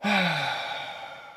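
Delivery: long, falling sigh; high sigh sliding down; exasperated sigh. Real, not acted. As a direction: let out a drawn-out sigh. Exasperated at fans, before "Dum-dums.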